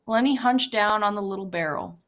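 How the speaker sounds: ordinary delivery, nothing marked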